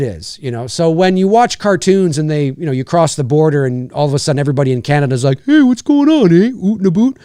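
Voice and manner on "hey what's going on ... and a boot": high, mock Canadian voice; cartoon Canadian accent. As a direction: do an over-the-top cartoon Canadian. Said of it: "Nobody talks like that".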